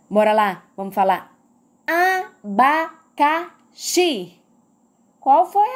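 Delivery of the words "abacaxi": "abacaxi" slowly split as a, ba, ca, xi, weight on the final xi; stress on XI. Shown as a demonstration. Teacher demonstrating the tonic syllable, "abacaxi" stressed on xi. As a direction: draw out "abacaxi", landing firmly on xi. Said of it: "A sílaba mais forte que eu pronunciei foi o xi".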